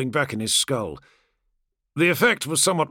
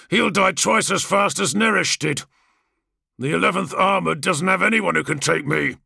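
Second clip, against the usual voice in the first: a touch higher, cocky and defiant brother; swaggering gladiator, barely hiding scorn; lightly voiced brash warrior; 5 semitones higher, 3 dB louder than usual. Kargos boasting.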